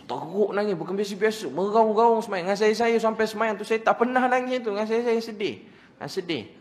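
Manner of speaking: high, loud, emotional sermon delivery; impassioned preaching. Real, not acted. Preacher retells a congregation wailing in prayer, impassioned. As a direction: preach it at full force, pitched high.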